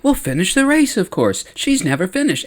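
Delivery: put-on high little kid voice; Kixter. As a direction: chirp it high, explaining like a small child. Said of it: "And Kixter's like".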